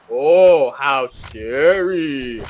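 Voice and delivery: slow mock-scared drawl, loud start; sarcastic. Shrek mocking the drop, slow sarcastic voice. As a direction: stretch it out, teasing. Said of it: "He's like".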